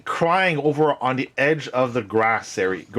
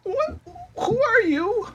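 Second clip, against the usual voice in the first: tearful startled creature. Acted. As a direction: squeak it out, small and wary. Crying Palasha startled by visitors.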